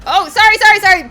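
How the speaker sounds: high, loud frantic apologies; loud, high, frantic stream of sorrys; panicked yelling. Real, not acted. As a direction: yell sorry in a panic as the crowd turns on you. Panicking as people attack him.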